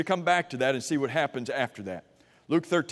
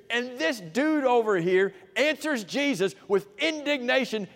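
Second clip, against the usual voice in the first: rising outrage; 7 semitones higher than usual. Real, not acted. Preacher building in outrage.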